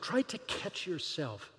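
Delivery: ordinary talk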